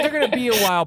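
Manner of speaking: high, tight reassurance; forced casual. Retold or imitated. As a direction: stall them nervously. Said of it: "He's like".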